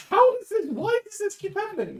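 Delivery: very high, loud incredulous squawk; exasperated outcry. Real, not acted. Player despairs at accent slipping, high exasperated yelp. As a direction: shriek it in disbelief.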